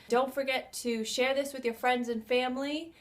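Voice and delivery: ordinary delivery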